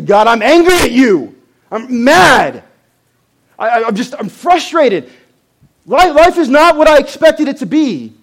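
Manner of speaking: high, loud anguished outburst at heaven; angry cry to God. Pretend. A worshipper shouting his anger up at God. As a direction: cry out to God, furious and hurt. Voicing a congregant's angry prayer.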